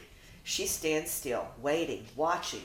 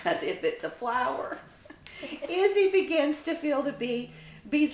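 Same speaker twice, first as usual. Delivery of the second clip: laughing; 9 semitones higher, 4 dB louder than usual. Real, not acted.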